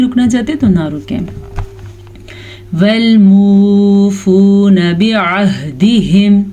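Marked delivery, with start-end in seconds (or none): 2.8-6.4 slow, drawn-out Quran recitation; tajweed recitation. Shown as a demonstration. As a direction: chant it slowly, stretching the vowels. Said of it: "میم پیش واو مو". (تجوید کے ساتھ آہستہ تلاوت)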